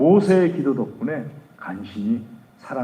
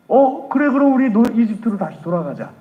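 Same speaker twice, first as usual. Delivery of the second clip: high, louder whiny voice of the complaining people; mock grumbling crowd; 9 semitones higher, 6 dB louder than usual. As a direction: play the fickle crowd giving up. Grumbling people quoted mockingly.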